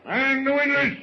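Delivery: roaring ship's command, high and loud; bellowed order. Acted. First mate roaring at the crew on deck, full bellow.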